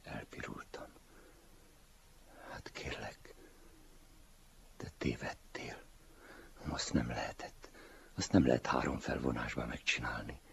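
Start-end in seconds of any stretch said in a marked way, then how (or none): 2.5-10.4 whispered, embarrassed excuse; ashamed whisper. Acted. Blushing older self whispering excuses to the young man.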